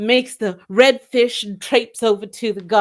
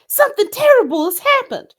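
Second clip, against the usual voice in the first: hammed-up alarm; 13 semitones higher, faster than usual. Redfish puppet distressed.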